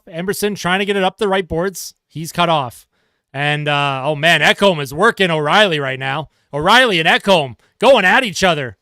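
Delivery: brisk hockey play-by-play call; sportscaster calling the action. Acted. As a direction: call the penalty kill live. Live play-by-play of penalty kill.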